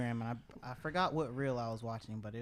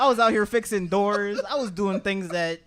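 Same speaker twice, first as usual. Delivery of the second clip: excited; 6 semitones higher, 8 dB louder than usual. Retold or imitated. Excited retelling of childhood work.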